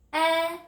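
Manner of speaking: 'eh' held long on a high level note; short E sound. Shown as a demonstration. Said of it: "Everyone say eh".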